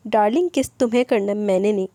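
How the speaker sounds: ordinary delivery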